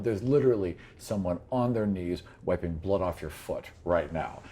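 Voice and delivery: normal talking voice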